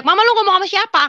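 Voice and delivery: mock yell, pitched high; husband calling out. Husband calls to his wife talking alone, mock yell. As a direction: call over to her, high-pitched. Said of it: "Suami saya teriak".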